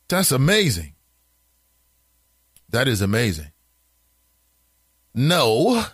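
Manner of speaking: ordinary delivery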